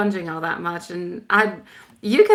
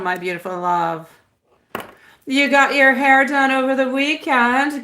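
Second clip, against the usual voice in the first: usual speaking voice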